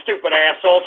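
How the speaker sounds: ordinary talk, nothing marked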